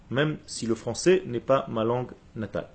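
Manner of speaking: normal talking voice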